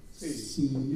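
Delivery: small, soft Italian "si"; quiet mimicked Italian reply; soft Italian imitation. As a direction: answer meekly as an Italian speaker. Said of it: "she says in Italian".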